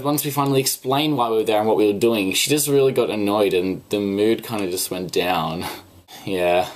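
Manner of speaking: normal talking voice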